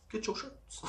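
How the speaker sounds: whispered through a giggle; laughing whisper. Real, not acted. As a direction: giggle it under your breath. Repeated plug, laughing take.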